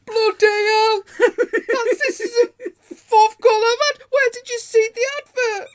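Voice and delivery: high, loud exasperated shouting, laughing through it; fat guy freaking out. Retold or imitated. Prank caller recalls the seller's fourth call, loud high exasperation. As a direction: bellow it up high as a man at his wits' end. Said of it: "the fat guy was like".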